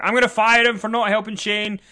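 high, loud outburst as a spurned boss; mock crazy rant. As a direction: rant like a jilted lover. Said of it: "crazy torn lover rant".

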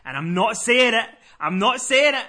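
high, loud, emphatic; stubborn refusal. Retold or imitated. Caller refusing to say who he is, loudly stubborn.

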